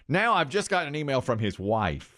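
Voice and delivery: ordinary delivery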